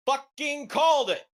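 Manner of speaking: high, loud gloating yell; a real burst of vindicated glee; triumphant shout. Real, not acted. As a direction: crow that you called it. Gloating over correct prediction.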